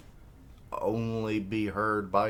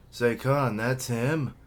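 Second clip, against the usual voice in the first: alarmed recognition; 6 semitones higher, 7 dB louder than usual. Acted. Recognizes the feared archer.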